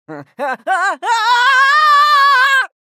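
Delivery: loud, high cackle building to a long shriek; mad scientist laugh. Acted.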